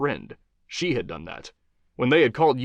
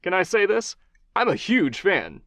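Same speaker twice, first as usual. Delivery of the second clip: higher, upbeat host voice; talk-show host Johnny; 8 semitones higher than usual. Acted. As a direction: charm the guest as a TV host. Host gushes.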